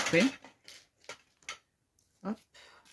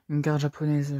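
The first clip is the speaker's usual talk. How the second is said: usual speaking voice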